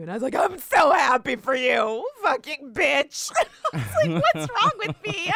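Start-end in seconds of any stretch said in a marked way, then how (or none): 0.4-2.1 loud, hammed-up wail; mock tearful congratulations. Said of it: "I broke down crying". 2.2-3.3 loud, slow, drawn-out curse; mock bitter outburst. Blurted insult in acted breakdown. 4.3-5.3 high, loud fake blubbering; mock crying. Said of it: "I broke down crying".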